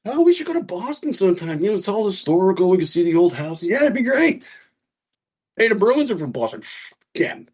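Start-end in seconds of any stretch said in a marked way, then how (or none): 0.1-3.6 high, chirpy mock wife voice; lightly voiced chirpy wife, pitched high; mimicking wife. Husband imitating his wife planning a Boston trip, high and chirpy. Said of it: "wife suggests Boston trip". 3.6-6.6 high, sly faux-innocent voice; mocking himself. Husband imitating himself sneaking hockey into the trip, high and sly. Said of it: "his own sly reply".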